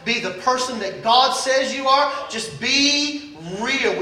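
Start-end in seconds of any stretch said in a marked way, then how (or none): 2.3-4.0 1 slow emphatic motto. Shown as a demonstration. Motto repeated slowly.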